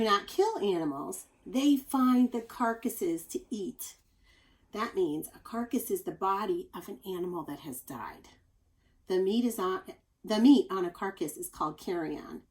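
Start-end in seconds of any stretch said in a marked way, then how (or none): 4.7-8.3 whispered explanation, unvoiced; whispered aside. Real, not acted. Teacher whispering a word's meaning to children. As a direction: lean in and whisper the definition.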